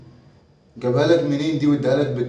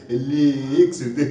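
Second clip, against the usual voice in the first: laughing; 3 semitones lower, slower than usual. Acted. Father laughing at son.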